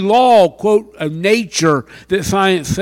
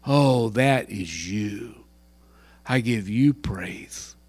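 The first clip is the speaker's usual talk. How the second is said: slow, soft, near-whispered praise; whispered prayer; 10 semitones lower, 10 dB quieter than usual. Pretend. The right way at a tulip, a whispered praise to God. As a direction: breathe it out to God in wonder.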